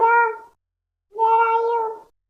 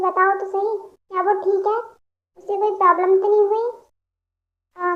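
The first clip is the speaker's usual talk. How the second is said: ordinary delivery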